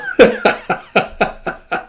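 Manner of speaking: a real airy giggle; high breathy giggle. Real, not acted. Reader amused by the panel, giggling.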